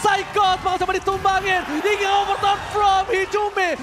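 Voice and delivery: high, loud shocked play call; hype shout. Real, not acted. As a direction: yell it like the game just flipped. Caster yells at a disaster play. (teriakan kaget komentator)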